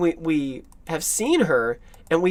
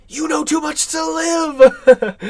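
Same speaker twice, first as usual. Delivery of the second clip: hammed-up desperate cry, high, loud and drawn out; imitated terror; 11 semitones higher, 7 dB louder, much slower than usual. Actor's madman pleading for his life, mock terrified. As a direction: wail it like a man in fear for his life. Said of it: "that is a man scared for his life".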